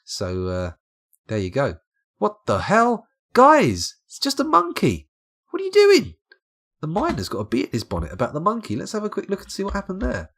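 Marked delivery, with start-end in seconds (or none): 2.2-6.1 high, loud mock outrage; mock exasperated disbelief. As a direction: cry out in put-on disbelief, voice shooting up. Scolds game characters over a monkey.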